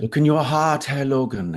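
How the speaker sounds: a shy German Nightcrawler, pleading; German accent. Retold or imitated. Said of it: "they need a, a German, some German accent".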